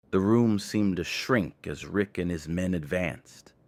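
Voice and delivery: plain reading voice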